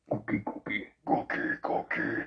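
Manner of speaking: low gruff munching monster voice; Cookie Monster voice. Retold or imitated. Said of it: "Cookie Monster".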